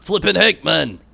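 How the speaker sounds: loud exasperated yell, high and punchy; shout. Acted. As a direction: blurt it out loud in frustration. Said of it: "Stop shouting".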